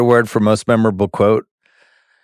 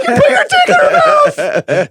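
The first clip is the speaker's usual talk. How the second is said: drawn-out high mock shriek; hammed-up furious wife screaming; screaming mad wife; 4 dB louder, slower than usual. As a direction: scream every word slowly, way up high. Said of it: "she just starts screaming at him".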